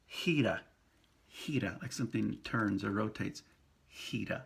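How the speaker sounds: gira as hira with a tapped r; Spanish gira. Shown as a demonstration. Teacher repeating gira for students, breathy h. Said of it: "it is equal to J I in pronunciation".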